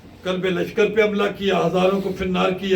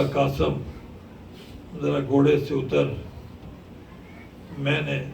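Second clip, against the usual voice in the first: tender plea; 4 semitones lower, 6 dB quieter than usual. Acted. Sakina asks Qasim to dismount.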